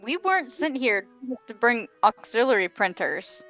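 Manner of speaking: ordinary talk, nothing marked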